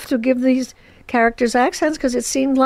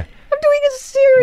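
much higher, louder, squealing with glee; delighted disbelief; 4 dB louder than usual. Real, not acted. An eighty-six-year-old actress marvelling to the host that she has her own series, delighted. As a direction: say it as if you still can't believe it.

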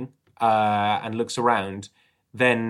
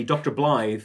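usual speaking voice

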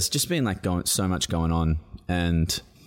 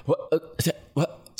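flustered what; 9 semitones higher than usual. Retold or imitated. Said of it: "you're like".

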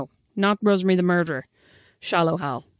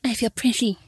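Irish accent; 2 semitones higher than usual. Shown as a demonstration. Repeating a film title for a puzzled sister, Irish accent. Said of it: "reclaim that Irish accent now".

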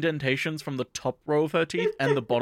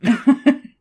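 loud, high cackle; laughing at the friend's punchline, loud and high; high burst of laughter; 12 semitones higher, 12 dB louder than usual. Real, not acted. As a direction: crack up loudly. Laughs at own joke.

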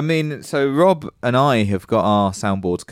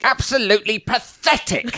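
high put-on voice, much higher; impression of Rob; 11 semitones higher than usual. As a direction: play Rob saying it, high. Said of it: "doing an impression of Rob".

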